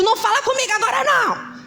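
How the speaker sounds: fast, high, sharp brush-off; wife snapping at husband, high and fast; irritated snap. Pretend. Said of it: "você tinha dito".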